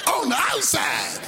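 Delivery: high, shouted, slow whooping sermon; a preacher whooping from the pulpit; full-force preaching. Acted. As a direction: shout it out as the climax.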